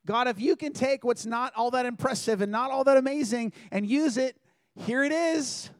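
an earnest plea, lifted high and loud; fervent prayer. Retold or imitated. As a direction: cry it out to God with everything. Teen girl's prayer, retold.

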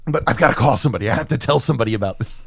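fast, breathless need to tell someone; rushed excited. Retold or imitated. Podcaster voicing a smitten guy, fast and giddy. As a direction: rattle it off, bursting with news.

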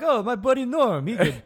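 very high squeaky voice; high goofy voice. Retold or imitated. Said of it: "Just be like".

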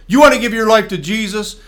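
loud, high altar call; preacher calling the shaking man to Jesus, loud. Retold or imitated. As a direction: call him out boldly.

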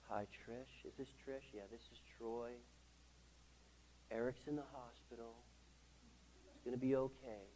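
very soft, calm phone-call voice; hushed imitation of a mild man; Troy. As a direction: deliver the news barely audibly, totally unruffled. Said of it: "He talks like this".

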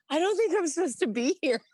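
very high whine; whiny young self. Retold or imitated. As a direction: plead like a lost kid. Private Benjamin style whine.

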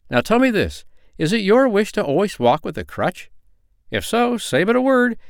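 high, lively questioning voice; earnest little man. A narrator's reading. Little man offers the girl her wish, earnest high voice.

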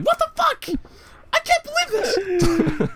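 very high, loud crying shriek; Nostalgia Critic screaming. Retold or imitated. Mocking the later reviews, shrieking very high and loud. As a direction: shriek in disbelief, cracking high. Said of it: "and he's like".

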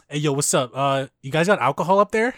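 loud, casual bro delivery; blunt, unceremonious shout-out; blunt dude imitation. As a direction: ask it bluntly, like a dude calling up to someone. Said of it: "he's very blunt".

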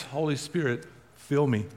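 a quiet, low plea to God; hushed prayer. Acted. Preacher models a waking prayer for the congregation, hushed.

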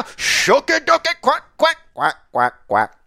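high squawky quacking duck. Pretend. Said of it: "I'll do it right now".

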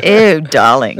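loud, hammed-up British accent; mock British darling. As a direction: camp British drawl for a laugh. Continuing mock posh bit.

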